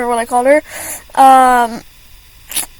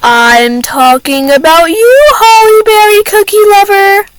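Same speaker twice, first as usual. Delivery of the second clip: playful callout; 7 semitones higher, 7 dB louder, slower than usual. Real, not acted. Calling out a friend.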